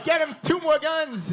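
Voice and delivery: high, loud rowdy egging on; hammed-up crowd hyping him; mock cheering. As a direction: locals cheering dad's threat. Long Islanders cheering dad on.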